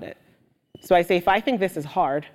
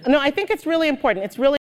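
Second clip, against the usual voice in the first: usual speaking voice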